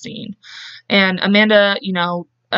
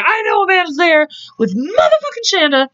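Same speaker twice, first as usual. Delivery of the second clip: put-on jealous girlfriend; 9 semitones higher than usual. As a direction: spit it out like a wronged girlfriend. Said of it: "She's like".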